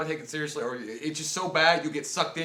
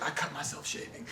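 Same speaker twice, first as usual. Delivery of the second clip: hushed, evasive mock delivery; soft, secretive aside; mock-mysterious whisper; 2 semitones lower, 12 dB quieter than usual. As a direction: whisper it like a guarded movie heroine. Mocking bartender's mysterious backstory.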